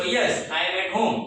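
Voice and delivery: slow, clear answer for learners; slow model answer. Shown as a demonstration. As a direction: lay each word out slowly. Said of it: "તો તમે કહી શકો". (ધીમો, સ્પષ્ટ જવાબ)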